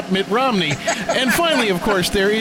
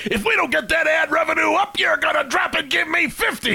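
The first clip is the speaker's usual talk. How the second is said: higher, barked like a drill sergeant on a mock tirade; 8 semitones higher than usual. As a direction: play a drill sergeant yelling at recruits over ad money.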